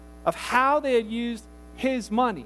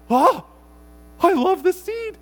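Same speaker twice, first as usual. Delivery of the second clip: 1 gushing delight; 7 semitones higher, 6 dB louder, slower than usual. Retold or imitated. Greedy manager voiced.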